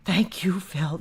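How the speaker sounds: breathless whispered thanks; awed whisper. Pretend. Starstruck fan whispering thanks to host Phil. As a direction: whisper it in awe of your hero. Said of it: "It would be like".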